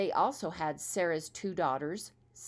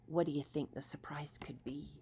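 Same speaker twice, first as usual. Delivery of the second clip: ordinary delivery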